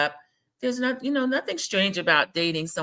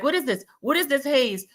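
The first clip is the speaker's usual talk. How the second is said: high-pitched puzzled questioning; imitated Kiki's puzzlement; 8 semitones higher than usual. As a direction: ask it high, imitating her confusion. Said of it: "Kiki's like".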